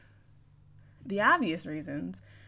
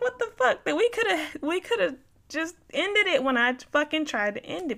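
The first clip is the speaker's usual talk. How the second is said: much higher, louder outraged cry; exasperated outrage; 10 semitones higher, 6 dB louder than usual. Retold or imitated. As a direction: erupt in disbelief at him. Retold furious reaction.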